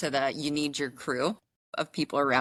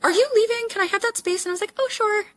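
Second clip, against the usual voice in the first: much higher and louder sugary mock voice; mock polite voice; 5 dB louder than usual. Woman mocking a man over a parking spot, sugary. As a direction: ham up the falsely sweet exchange.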